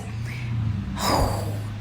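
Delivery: long, low exhaled sigh; heavy sigh. Real, not acted. Storyteller concerned about what she saw, long sigh. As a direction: let out a soft, drawn-out breath. Sighs while recalling worry.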